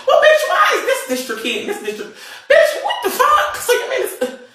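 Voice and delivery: high-pitched laughing disbelief; laughing outrage. Real, not acted. Telling viewers about the police runaround, squealing with laughter.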